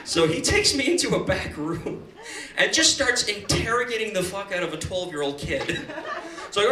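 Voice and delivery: normal talking voice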